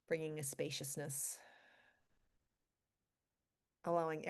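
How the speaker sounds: calm meditation guide with long pauses; guided meditation. Acted. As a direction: ease them gently into stillness, leaving space between phrases. Leads a centering meditation.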